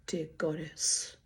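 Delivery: ordinary delivery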